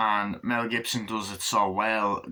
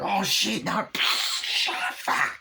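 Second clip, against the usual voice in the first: panicked driver; 10 semitones higher, much slower than usual. Acted.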